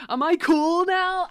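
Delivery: mock bravado. Asking chat, slow and loud.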